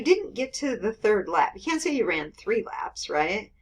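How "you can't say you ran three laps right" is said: normal talking voice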